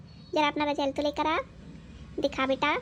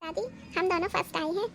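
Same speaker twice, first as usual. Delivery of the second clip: put-on high, proud little girl; a child boasting she came first; girl's voice; 4 semitones higher than usual. Granddaughter announcing results. (ऊँची बच्ची की आवाज़)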